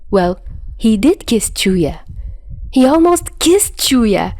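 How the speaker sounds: ordinary delivery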